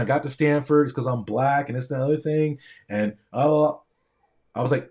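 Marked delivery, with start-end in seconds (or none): none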